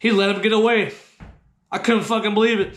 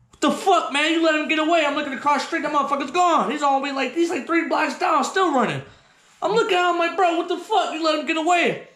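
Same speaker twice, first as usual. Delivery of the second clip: high-pitched, incredulous complaining; exasperated protest; 8 semitones higher, faster than usual. Retold or imitated. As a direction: scold your friend in disbelief. Scolding friend for letting him escape.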